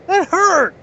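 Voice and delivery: high-pitched mock cry of pain; imitated hurt complaint, high and punchy; Al Snow. Retold protest after chair hit.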